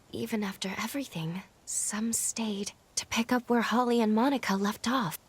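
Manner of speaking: hushed whisper, careful not to be overheard; cautious whisper. Acted. Sneaking into the sanatorium.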